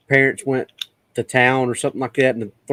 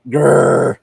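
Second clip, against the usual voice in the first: short, loud imitated growl; dog man growl; 6 dB louder than usual. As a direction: growl it out like a beast. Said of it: "hearing it growl".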